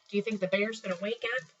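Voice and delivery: ordinary delivery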